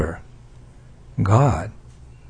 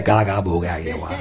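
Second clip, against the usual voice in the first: put-on softer, lower baby babbling through laughter; baby babble; 9 semitones lower, 6 dB quieter than usual. Mother repeats her baby's first babbling, laughing. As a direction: babble like an infant. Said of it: "Just like the babbling of a child".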